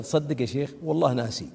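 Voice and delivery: low, soft retold reply; imitated quiet admission. Feuding cousin admitting to the sheikh he forgot, imitated low quiet reply. Retells man's sheepish admission.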